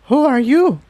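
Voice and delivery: high, startled mare voice blurting it out; sputtering young mare. Acted. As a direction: caught off guard, sputter the question up high. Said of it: "Tiptoe sputtered".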